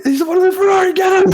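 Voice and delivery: very high, loud strained yelling; mock frantic shout. Pointing police at co-host, mock frantic shout. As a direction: squeal the accusation in play panic. Acts being hauled to jail.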